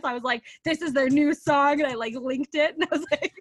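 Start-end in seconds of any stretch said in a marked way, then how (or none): 0.6-1.8 loud, higher, insistent fan proof; mock outrage. Proving the band's relevance to the editor, mock indignant. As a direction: press the point with hammed-up indignation. Defending favourite band to editor.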